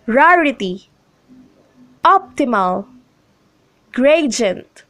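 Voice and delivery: RARity, OPtimal, GRAdient, opening syllable stressed; first-syllable stress. Shown as a demonstration. Teacher reads examples Rarity, optimal, gradient, each stressed on the first syllable. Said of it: "the first syllable usually gets the stress".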